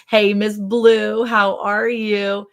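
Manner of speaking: slow, singsong hello to a friend; drawn-out greeting. Real, not acted. Greeting a viewer by name.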